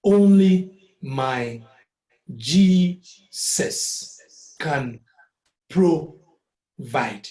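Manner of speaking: "Only my Jesus can provide" dragged out loud and slow. Shown as a demonstration. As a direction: spell out each syllable slowly. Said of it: "Pro hyphen vide".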